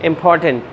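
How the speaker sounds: "important" with a strong aspirated P on POR; "important" with weight on POR; stress on P. Shown as a demonstration. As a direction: hit the P in "important". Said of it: "पी पे यहाँ पर stress".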